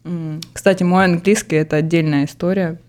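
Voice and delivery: usual speaking voice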